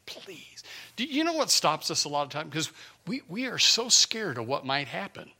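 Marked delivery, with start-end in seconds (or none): 0.0-0.6 whispered plea to God; pouring out the heart. Retold or imitated. Preacher urging people to pour out hearts, whispered pleading. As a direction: beg in a whisper, fading away. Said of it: "Pour out your heart".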